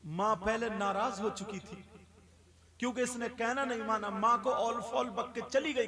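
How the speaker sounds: usual speaking voice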